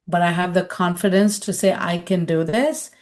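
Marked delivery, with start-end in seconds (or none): none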